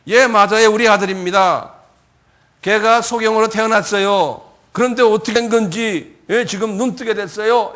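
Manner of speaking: emphatic parents' answer. Acted. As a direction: answer the questioners loud and emphatically.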